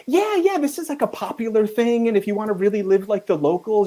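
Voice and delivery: high, eager "yeah, yeah" fixer; insistent fixer. Retold or imitated. Fixer pushing the crew to live like locals, eager. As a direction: sell the local custom eagerly.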